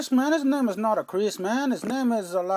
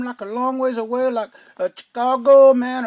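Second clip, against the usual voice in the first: ordinary delivery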